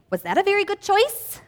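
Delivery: a hint of a mommy teacher; grown-up voice. Teacher imitating parents questioning a child's choice, lightly voiced. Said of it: "your grown-ups probably say".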